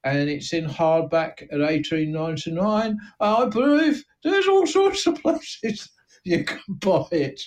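a small-time crook boasting, thin Cockney voice; East End gangster. Acted. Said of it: "I'm searching for the right note for this voice".

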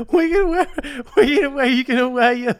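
amused disbelief. Real, not acted. Friend mocking disbelief.

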